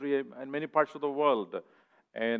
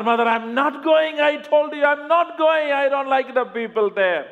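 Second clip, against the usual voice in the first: much higher, loud whining refusal; whiny son; 14 semitones higher, 7 dB louder than usual. Acted. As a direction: whine like a stubborn boy.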